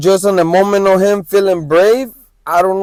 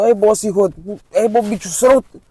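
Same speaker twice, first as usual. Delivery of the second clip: Honduran slang; 4 semitones higher than usual. Retold or imitated. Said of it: "a Honduras calling you".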